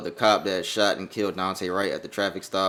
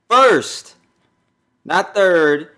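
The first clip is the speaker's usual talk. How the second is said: "first" punched much higher and louder; stress on first; 11 semitones higher, 14 dB louder, much slower than usual. Real, not acted. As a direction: hit "first" hard, then "not third". Stressing the conviction degree.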